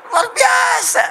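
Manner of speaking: very high, loud cry of amazement; triumphant high-pitched yell; exclaiming shout. Real, not acted. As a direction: shout it out in wonder, stretching it. Rousing exclamation to the crowd.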